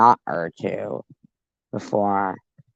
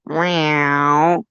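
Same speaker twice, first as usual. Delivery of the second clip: high drawn-out meow; mock kitty meow; cat meow; 7 semitones higher than usual. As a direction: call like a cat to get the cats going. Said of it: "Get those cats going".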